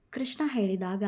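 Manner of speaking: ordinary delivery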